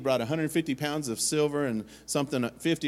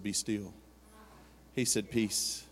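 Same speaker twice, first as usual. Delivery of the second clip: gentle hush; 5 semitones lower, 9 dB quieter, slower than usual. Retold or imitated. The Lord calming him.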